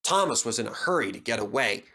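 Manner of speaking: ordinary delivery, nothing marked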